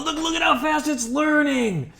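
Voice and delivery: excited shout. Real, not acted. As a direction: yell it out in delight. Thrilled by model training speed.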